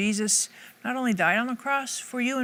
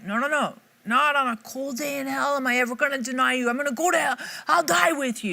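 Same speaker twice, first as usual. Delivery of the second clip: emphatic; 6 semitones higher than usual. Acted. Peter protesting to Jesus at the Last Supper, emphatic. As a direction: vow loyalty emphatically, pitched up and insistent. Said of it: "Peter's emphatic".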